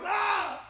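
sudden high yelp, mock pain; imitated yelp of pain. Retelling brother's yelp when stung, high mock yelp. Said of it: "he makes this noise".

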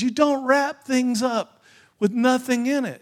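high, loud, drawn-out scolding; upset father. Retold or imitated. Upset dad scolds his little girl over an empty box, loud and exasperated. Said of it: "he gets upset again".

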